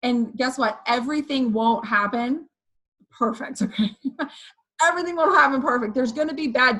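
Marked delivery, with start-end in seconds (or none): none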